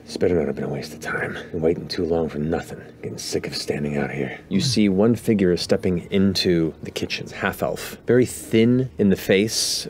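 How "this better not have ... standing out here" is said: softer, slightly low grumble of a bored villain; hint of a fed-up intruder; lightly voiced impatient thug. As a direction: grumble like a henchman tired of waiting. Game master voices waiting thug.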